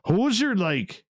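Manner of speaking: a hint of a Canadian accent, hoser high and falling. Sandra's slip mocked in a light Canadian accent. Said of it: "That's the accent".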